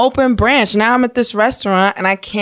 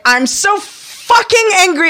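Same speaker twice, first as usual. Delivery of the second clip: high, drawn-out angry outburst; mock losing it; 11 semitones higher, slower than usual. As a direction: erupt in high-pitched acted frustration. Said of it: "what I'll do is, like".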